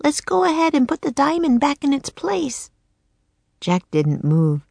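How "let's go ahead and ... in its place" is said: put-on high little-girl voice, croaked; a girl turned raven, croaking. Annie to Jack in the nursery, high croaking girl. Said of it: "croaked Annie".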